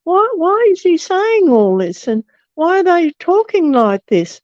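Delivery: high, swooping, baffled questions; puzzled why-questions, pitch lifted well up; bewildered questioning. Retold or imitated. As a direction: retell a baffled inner protest. Retells her bewildered thought in court.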